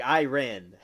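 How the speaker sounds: Iran said eye-RAN; odd local pronunciation. Shown as a demonstration. Amused by own country's way, Iran as eye-RAN. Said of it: "we're saying Iran".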